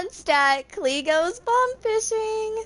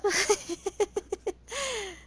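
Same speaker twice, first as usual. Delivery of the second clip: quiet, high giggle; real soft giggling; soft chuckling; 5 semitones higher, 8 dB quieter than usual. Real, not acted. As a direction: chuckle softly as the laughter winds down. Still amused by the running gag.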